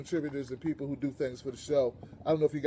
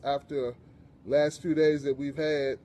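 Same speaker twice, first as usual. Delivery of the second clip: ordinary talk, nothing marked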